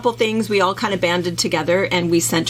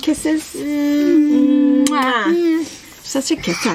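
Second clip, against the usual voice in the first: put-on baby talk; 7 semitones higher, much slower than usual. Cooing at the baby.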